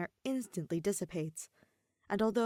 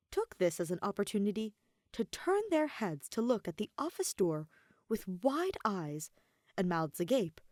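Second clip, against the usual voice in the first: plain reading voice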